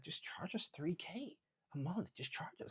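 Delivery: soft, slow hint of an American accent, trailing to a whisper; lightly voiced American client, quiet. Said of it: "she's American".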